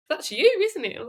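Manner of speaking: high-pitched mock incredulous dad; imitated disbelief. Imitating dad fooled by the digital twin, incredulous. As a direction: pitch it way up, playing a baffled parent.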